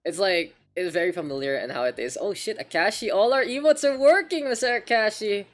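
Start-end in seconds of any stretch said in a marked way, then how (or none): none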